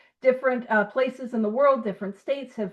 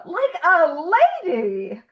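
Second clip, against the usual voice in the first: drawn-out, sing-out mock elegance; playfully put-on daintiness; mock ladylike; 5 semitones higher, 4 dB louder, slower than usual. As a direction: stretch it out, higher, like a grand lady. Mock-dainty joke about herself.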